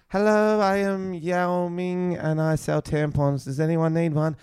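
high, slow put-on Chinese accent; hammed-up Chinese accent selling wares; mock Chinese accent. Student in drama game as townsperson, mock Chinese accent. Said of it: "you can't do that accent".

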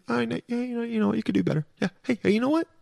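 high, soft supportive listener voice; encouraging listeners. Retold or imitated. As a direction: imitate fans cheering Dylan on gently. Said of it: "you're thinking".

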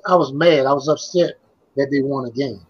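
ordinary talk

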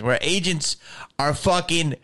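ordinary talk

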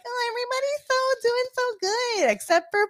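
high, cooing mock praise like a doting mom. Host mocking lenient ratings as sweet mom. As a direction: gush over everyone like a sweet mom. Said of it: "We're moms. We're like".

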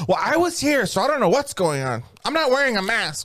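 high, mocking complaint voice; entitled customer. Mocking a customer who skipped the ticket, in a high whine. Same mocked customer replies.

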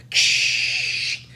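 unvoiced shh of TV static; static hiss. Telling friends about a scary dream, static hiss noise. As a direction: imitate the fuzz of a dead TV channel.

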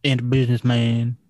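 lightly voiced basketball star; Shaq. Co-host attempts Shaq for his friend, drawling impression. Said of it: "one I was thinking was Shaq".

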